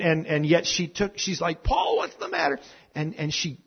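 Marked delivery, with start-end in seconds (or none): 1.6-2.6 much higher, anxious mother's call; a startled mom calling out to her son; mother hearing her boy scream; alarmed mother. Retold or imitated. Mother hears her child screaming.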